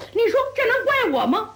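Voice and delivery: high-pitched, aggrieved appeal; indignant protest. A narrator's reading. Brawler asks his brother who is to blame, indignant. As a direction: plead innocence with indignation.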